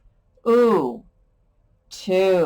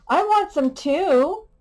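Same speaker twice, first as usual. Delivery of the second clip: put-on higher, slower kid asking; pretend child; 9 semitones higher, slower than usual. Teacher acting a child asking for breakfast: higher eager voice. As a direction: ask like an eager little kid. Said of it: "sample sentence for too".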